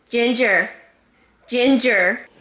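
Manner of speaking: loud, sharp call; calling the cat. Real, not acted. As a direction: call her off the bed.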